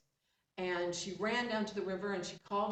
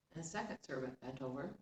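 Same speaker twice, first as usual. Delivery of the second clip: plain reading voice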